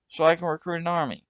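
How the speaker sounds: put-on brash young punk making his pitch; the kid's voice.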